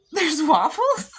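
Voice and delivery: loud, high and drawn-out disbelief; mock astonished question. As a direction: squawk it in hammed-up surprise. Boyfriend's surprised question, retold.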